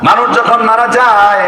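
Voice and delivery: high, impassioned preacher's cry; fervent preaching. Acted. Preacher to the congregation about prayer, fervent high preaching. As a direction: lift the voice and preach to the crowd.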